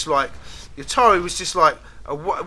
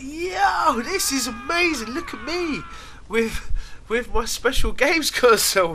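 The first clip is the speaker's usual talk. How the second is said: excited; 8 semitones higher, slower than usual. Retold or imitated. Retells joy at new console.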